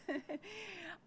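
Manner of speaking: quick embarrassed laugh; a light self-conscious chuckle; short laugh. Real, not acted. Embarrassed after apologizing for voice.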